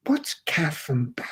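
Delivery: a hushed, unvoiced "what's caf and baf?"; a clerk asking Alan in a confiding whisper; whispered question. Clerk asks him quietly.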